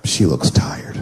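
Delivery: low whisper to himself; whispered aside. Retold or imitated. Husband whispering about his sleeping wife, hushed. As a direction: whisper it in pity.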